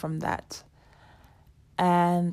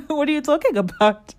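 higher, laughing and quicker; amused receptionist; 9 semitones higher, 6 dB louder, faster than usual. Retold or imitated. Receptionist to the anxious customer, laughing and higher. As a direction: laugh it off as obvious.

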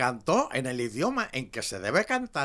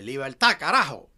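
louder shouted slogan, imitating the president; mock political rally cry; 8 dB louder than usual. To the cohost, aping the Argentine president's cry, loud. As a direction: chant it like a rally speech. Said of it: "que decía".

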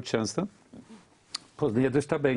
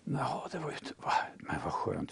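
breathy hushed whisper, much softer; a retold reply, whispered; 10 dB quieter than usual. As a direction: drop to a whisper of relief. Retold relieved reply to caller.

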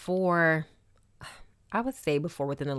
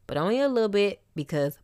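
ordinary delivery

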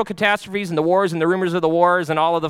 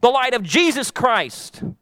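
preaching that climbs higher and louder; building sermon; 5 semitones higher, 4 dB louder than usual. Acted. Pastor urging the congregation toward the Holy Spirit, preaching higher and louder.

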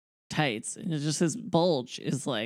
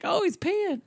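much higher, squealed imitation; mock exclamation; 4 dB louder than usual. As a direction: blurt it out high like a tickled onlooker. Mimics the friends' amused outburst.

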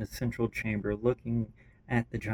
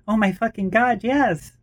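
high, loud thrilled outburst; excited shout; 11 semitones higher, 8 dB louder than usual. Acted. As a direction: shout it in pure delight. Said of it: "Babs' voice rings loudly".

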